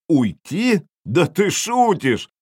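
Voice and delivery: high, drawn-out scoffing shout; incredulous yell. A narrator's reading. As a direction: yell it back in mock disbelief. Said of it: "завопил я".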